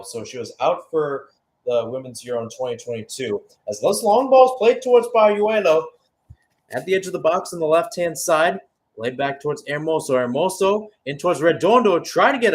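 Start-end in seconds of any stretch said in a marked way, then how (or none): 3.7-12.5 rising excitement over the attack; live play-by-play. Real, not acted. Commentator calling a Spain attack, excited play-by-play. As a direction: call the attack as it builds.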